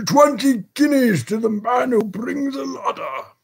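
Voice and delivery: very high, ringing call; furious, far-carrying cry; cry above all others. A narrator's reading. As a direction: shout an offer over a roaring crowd. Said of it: "cried beneath the window in a voice that rose above all others".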